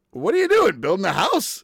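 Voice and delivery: high, loud mock neighbor asking; mock nosy neighbor. Neighbor stopping by the bus, high loud mock question.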